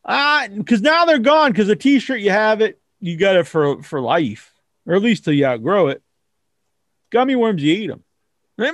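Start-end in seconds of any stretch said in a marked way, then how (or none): none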